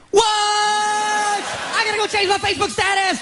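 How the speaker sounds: high-pitched shriek of excitement; hammed-up frantic outburst; Darwish. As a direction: scream it like an overjoyed fan. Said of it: "he goes".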